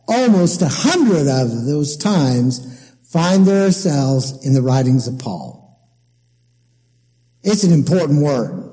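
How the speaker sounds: normal talking voice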